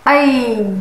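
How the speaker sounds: long, gliding "ऐ"; drawn-out teacher's "ऐ"; vowel sound demo. Shown as a demonstration. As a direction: stretch "ऐ" out, letting it fall. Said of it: "आप उसे इस तरह से सिखाएं".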